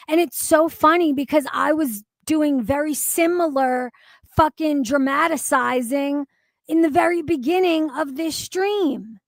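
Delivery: ordinary talk, nothing marked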